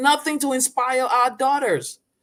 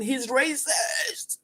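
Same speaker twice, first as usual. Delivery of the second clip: drawn-out, high mock accusation; hammed-up, slow outraged whine; mocking the accusers; 5 semitones higher, much slower than usual. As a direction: imitate people crying foul, stretched out. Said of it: "y'all saying".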